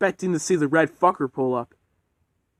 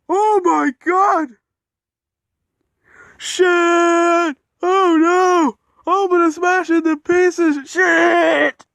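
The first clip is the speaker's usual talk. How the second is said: high, loud terrified yelling; panicked scream; 7 dB louder, much slower than usual. Acted. Edward screaming in fear of crashing, panicked scream. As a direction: shriek as if about to crash. Character about to smash, screaming.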